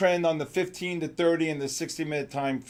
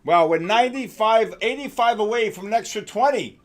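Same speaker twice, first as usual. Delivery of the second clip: higher and louder, racing through the gold price; thrilled live call as gold moves; real excitement at the numbers; excited; 7 semitones higher, 6 dB louder than usual. Real, not acted. Gold price jumping live on stream.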